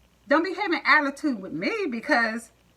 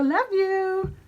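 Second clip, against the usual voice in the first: cutesy sign-off; 8 semitones higher, much slower than usual. Real, not acted. Sign-off to viewers.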